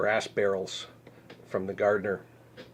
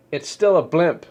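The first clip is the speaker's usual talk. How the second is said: scoffing; 7 semitones higher, 8 dB louder than usual. Real, not acted. Scoffing at blimp news.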